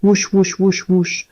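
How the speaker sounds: mimicked fetal heartbeat sound; imitated heartbeat whoosh. A narrator's reading. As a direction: breathe out the pulse of the monitor. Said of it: "Un son remplit la pièce".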